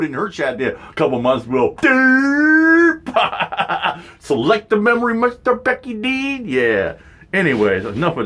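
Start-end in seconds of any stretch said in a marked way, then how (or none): 1.8-3.0 mocking derp. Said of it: "mocking noise". 4.2-6.5 mock announcer. Mocking Becky.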